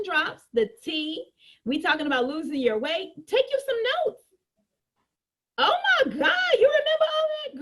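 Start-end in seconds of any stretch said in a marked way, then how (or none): 5.6-7.6 high, loud gasp of amazement; be stunned she remembered; a customer amazed she remembered everything. Acted.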